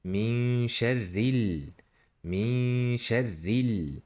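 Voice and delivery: slow "Min sharril" with hummed n and lingering final l; held sukun. Shown as a demonstration. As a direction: hold the l closing "sharril". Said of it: "you should hold, uh, the sukun".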